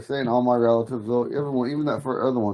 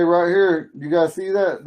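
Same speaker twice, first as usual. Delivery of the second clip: normal talking voice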